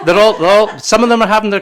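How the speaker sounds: normal talking voice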